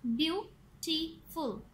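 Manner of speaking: "Beautiful" slowly in three beats; "beautiful" broken into three syllables. Shown as a demonstration. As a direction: say "beautiful" as view, टी, फुल with pauses. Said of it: "three syllables".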